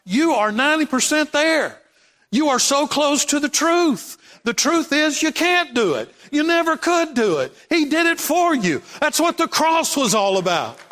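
high-pitched, emphatic sermon delivery; fervent preacher driving the point home; impassioned preaching. Real, not acted. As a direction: preach it with rising conviction, pitched well up. Preaching at full force.

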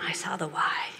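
soft whisper; whispered discovery. Real, not acted. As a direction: hush it like a secret. Quiet reveal.

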